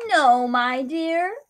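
slow, lightly voiced old woman; gently as an old woman. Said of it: "the woman replied".

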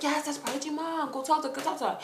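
soft, high insistence; hushed urging. Retold or imitated. Friend urging her to approach mom, hushed. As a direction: nudge someone on in a hushed voice.

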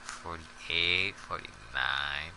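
counting softly under breath; quiet counting. Real, not acted. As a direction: mutter the count to yourself.

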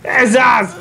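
excited shout. Real, not acted. Gamer in a tight fight, high frantic shouting.

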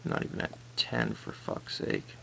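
frustrated whispered curse; whispering. Real, not acted. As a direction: curse under your breath. Frustrated whisper mid-game.

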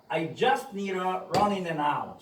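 I just need a running and out을 천천히 이어서; 따라하도록 느리게 시범; slow model sentence. Shown as a demonstration. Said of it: "시작". (느린 시범 문장)

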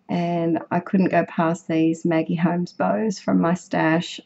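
usual speaking voice

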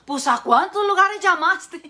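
the parrot gasping at Jesus' phone bill. Acted. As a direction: drag out the shock like a cheeky parrot.

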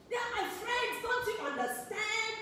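put-on upset teenager. Teen daughter arguing with her mom about friends, high protest. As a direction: whine back at mom like a stubborn teen. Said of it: "I used to get so upset with my mom".

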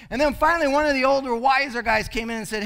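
usual speaking voice

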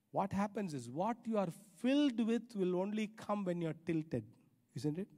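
usual speaking voice

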